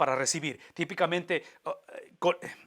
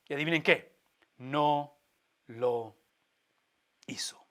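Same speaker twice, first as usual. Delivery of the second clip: words held apart by long pauses, fading away; dramatic pauses; 3 semitones lower, slower than usual. Real, not acted. Preacher reveals to the congregation that God did not punish, in paused, fading words. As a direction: let each word land, then drop off.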